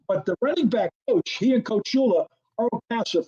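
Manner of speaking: usual speaking voice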